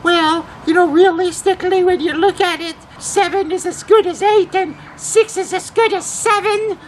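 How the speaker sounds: put-on very high falsetto old lady; little old lady. Prank caller delivering the catch line to the host, little old lady. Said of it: "launch into the catcher line".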